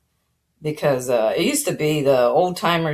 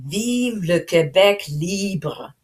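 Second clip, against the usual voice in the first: slow, drawn-out French proclamation, lightly imitated; French rally cry; 4 semitones higher, much slower than usual. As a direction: declaim it slowly in French like a politician at a rally. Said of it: "he finally said".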